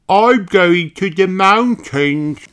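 high, slow puppy voice; Danny Dog voice. Acted. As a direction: voice an eager little dog.

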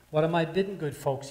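loud calling auctioneer; auctioneer's cry. Acted. Auctioneer calling to the crowd, loud bidding cry. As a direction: cry it out to the room. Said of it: "he cried".

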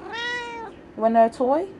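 very high, slow coo. Real, not acted. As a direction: sing it to the cat. Offering the cat a toy.

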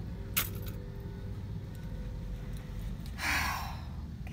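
soft, shaky breath out to steady herself; emotional sigh. Real, not acted. Overwhelmed by her product in a store, a tearful sigh. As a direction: let out a moved, tearful sigh. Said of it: "trying so hard not to cry".

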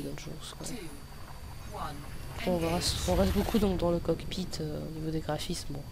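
ordinary delivery

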